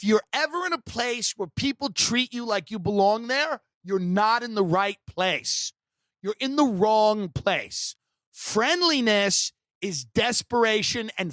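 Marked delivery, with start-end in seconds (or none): none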